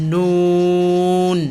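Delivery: নুন drawn out with a hum in the nose; lightly nasal. Shown as a demonstration. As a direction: hold নুন long and tuck it lightly into the nose. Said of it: "নাকের ভিতরে হালকা একটু লুকাবে".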